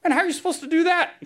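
high, quick, squeaky disbelief; preacher mocking his own dismay at the verse, high and fast; exasperated self. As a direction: play your own baffled reaction big. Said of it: "And I'm going".

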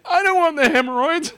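high, squeaky mock whine; whiny refusal. Ekron refusing the Ark, high squeaky mock whine. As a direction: whine it like a terrified townsman. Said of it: "Ekron's like".

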